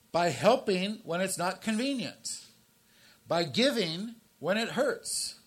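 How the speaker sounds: loud, high, driving preacher's voice; full-force preaching. Acted. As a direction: build the list like a sermon climax.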